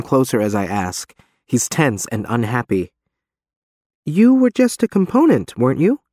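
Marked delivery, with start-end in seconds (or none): 4.0-6.0 high, louder pressing question; Reeve's voice. A narrator's reading. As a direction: push him with a raised voice.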